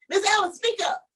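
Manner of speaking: lightly voiced teacher demanding volume; teacher telling me off. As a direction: make her speak up. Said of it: "So she said".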